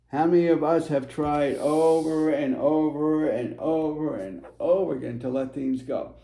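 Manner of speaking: usual speaking voice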